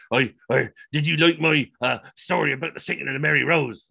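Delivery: lightly mock an over-earnest history buff; imitating an intense stranger; intense oddball. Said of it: "one of the most intense people".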